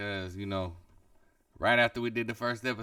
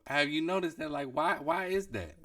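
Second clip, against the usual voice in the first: raised, animated questioning; excited; 8 semitones higher than usual. Real, not acted. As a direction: press the point, voice up high. Pressing his point, animated.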